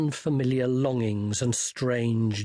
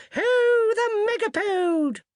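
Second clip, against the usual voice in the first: very high, louder hunting hollo; wizard's yell; 5 dB louder, slower than usual. Acted. Wizard riding piggyback yelling the hunt, very high and loud. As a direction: hollo it out as the chase resumes. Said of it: "a wizard yelled".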